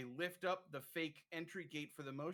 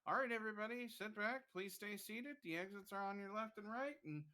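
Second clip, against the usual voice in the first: high, cheery announcer spiel; sing-song theme park host; ride attendant voice; 8 semitones higher than usual. Retold or imitated. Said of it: "the ride attendant is like".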